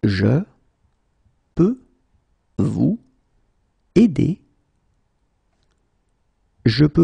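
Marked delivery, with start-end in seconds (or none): 0.0-4.4 long pause after every word; slow, word by word. Shown as a demonstration. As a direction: say Je, peux, vous, aider one by one. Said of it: "teacher drills word by word".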